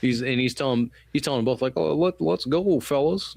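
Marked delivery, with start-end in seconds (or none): 1.8-3.3 high, slow, timid coaxing; mock wimpy pleading. O'Neill telling them both to go, in a mock wimpy plea. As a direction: plead weakly, imitating a nervous soldier. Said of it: "just a real pussy".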